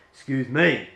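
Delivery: loud, indignant objection; really annoyed. Shown as a demonstration. Director voices someone whose toes were stepped on, annoyed. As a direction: turn on someone who pushed in, annoyed. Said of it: "like, you're really annoyed".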